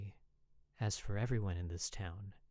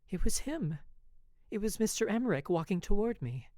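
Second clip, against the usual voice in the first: higher, louder, breathless rush; a thrilled gasp of recognition; excited; 9 semitones higher, 5 dB louder than usual. A narrator's reading. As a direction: heart leaping as she spots him.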